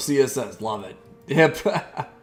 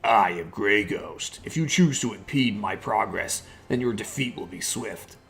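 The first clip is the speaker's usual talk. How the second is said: ominous low voice; 5 semitones lower than usual. Acted. Voices the Grey Ghost character.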